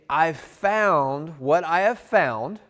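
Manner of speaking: drawn out, very slow delivery; slow demo line. Shown as a demonstration. Salesman slowly demoing his pitch line, drawn out. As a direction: let each word hang slowly. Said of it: "and then when I say".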